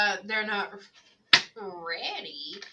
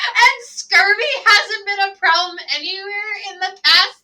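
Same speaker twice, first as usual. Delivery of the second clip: laughing; 11 semitones higher, 13 dB louder, slower than usual. Real, not acted. Retelling her own absurd texts.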